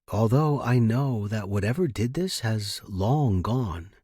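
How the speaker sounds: usual speaking voice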